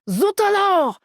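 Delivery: hammed-up French accent; mock French accent. Guide announcing Jean-Loup's bathroom pals, mock French accent. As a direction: exclaim and explain like a stage Frenchman, French accent.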